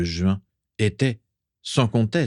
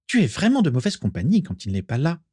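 high, lilting reproach; singsong voice; 7 semitones higher, faster than usual. A narrator's reading. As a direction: chide in a high singsong girlish voice. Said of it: "de sa voix chantante". (reproche chantant, voix aiguë)

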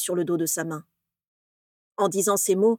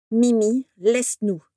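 curt; 2 semitones higher, 4 dB louder, slower than usual. Acted. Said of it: "demanda sèchement Régulus".

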